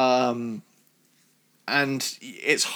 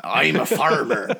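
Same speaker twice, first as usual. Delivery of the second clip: a light rural British "I'm a farmer"; farmer accent; 3 dB louder than usual. Retold or imitated. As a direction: lightly imitate a West Country farmer. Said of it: "how a farmer would talk".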